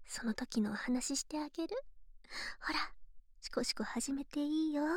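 soft intimate whisper. Acted. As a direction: whisper close to the ear.

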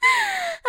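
a quick falling high-pitched laugh; high mocking giggle. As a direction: laugh down at him, delighted. Laughs at him after climax.

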